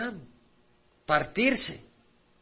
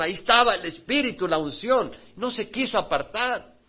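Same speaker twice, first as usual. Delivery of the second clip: loud, driving preacher's exhortation; full-force preaching; 5 semitones higher, 6 dB louder than usual. Acted. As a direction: preach it out to the congregation. Predicación a plena voz. (predicación a toda voz)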